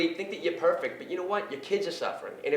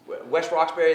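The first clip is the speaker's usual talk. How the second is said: ordinary talk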